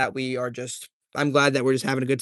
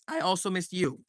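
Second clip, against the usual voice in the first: voice crack; 9 dB quieter than usual. Real, not acted. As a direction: voice cracking on the words. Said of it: "I just did a voice crack".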